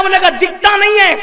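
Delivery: put-on a loud, high "دکھتا نہیں ہے" as a rude young biker; arrogant youth snapping. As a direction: bark it like a cocky young man blaming others. Rude young man voiced. (گھمنڈی نوجوان کی اونچی، بدتمیز جھڑک)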